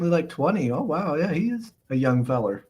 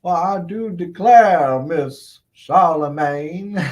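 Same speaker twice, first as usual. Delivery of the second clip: slow, drawn-out genteel Southern drawl; Southern accent; 2 semitones higher, 6 dB louder, much slower than usual. Pretend. Streamer playfully posing as plantation owner, Southern accent. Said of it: "I'll talk in a southern accent".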